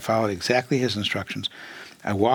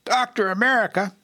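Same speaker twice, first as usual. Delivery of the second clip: high booming call of a name, retold; bellowed name; 11 semitones higher than usual. Guru calling his disciple from afar, booming call. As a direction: bellow it out like a guru summoning across the ashram. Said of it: "he kind of bellowed out".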